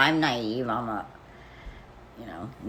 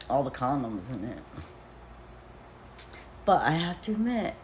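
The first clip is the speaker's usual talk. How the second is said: ordinary talk, nothing marked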